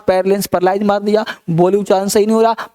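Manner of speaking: rattled off fast; quick drill repetition of the definition; fast recap. Shown as a demonstration. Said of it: "मेरे साथ तेज दोहरा दो".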